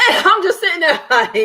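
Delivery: cracking up over the lawyer's mistake; laughing. Real, not acted. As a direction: laugh out at the absurdity. Laughing at the courtroom mix-up.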